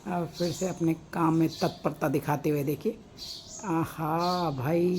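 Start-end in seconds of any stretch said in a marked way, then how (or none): 3.7-4.9 long drawn-out admiring aha; slow, savoring wonder; marveling at the birds, drawn out; delighted aah. Real, not acted.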